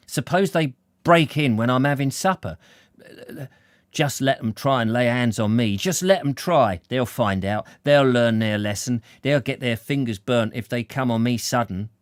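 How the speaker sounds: worked-up man daring them to try; indignant character bragging to himself, firmly projected; defiant working man. Acted. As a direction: talk yourself into a fight, loud and emphatic.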